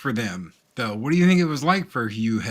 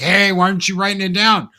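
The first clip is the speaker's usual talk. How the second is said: raised voice; 7 semitones higher, 7 dB louder than usual. Retold or imitated. Retold argument over a videotape.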